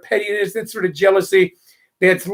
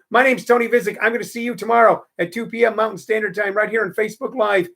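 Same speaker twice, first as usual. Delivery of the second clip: usual speaking voice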